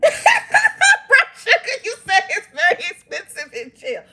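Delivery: high, slow laugh through the words. Real, not acted. Answering a viewer comment while laughing hard.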